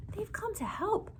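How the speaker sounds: whispered secret. A narrator's reading. Wise woman whispering to the old woman.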